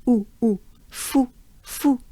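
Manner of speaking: ooh and fou held closed and dry. Shown as a demonstration. Teacher drills closed ooh and fou for learners. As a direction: model a tight rounded ooh then fou. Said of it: "very closed up and kind of dry".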